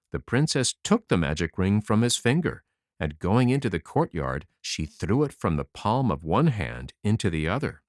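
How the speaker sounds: ordinary delivery, nothing marked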